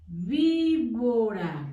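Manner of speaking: "Víbora" drawn out very slowly for dictation; dictation pace. Shown as a demonstration. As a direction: say "Víbora" very slowly so children can write it. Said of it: "Así se escribe víbora". ("Víbora" muy lento, para dictar)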